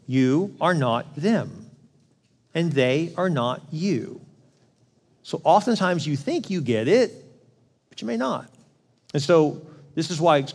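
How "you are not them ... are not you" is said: very slow, every word weighed; slow and deliberate. Real, not acted. Preacher to congregation, slow and deliberate. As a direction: let each word land slowly. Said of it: "This is important".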